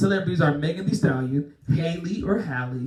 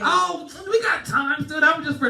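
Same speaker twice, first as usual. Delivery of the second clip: excited reassurance; 9 semitones higher than usual. Real, not acted. Reassuring guest to stay.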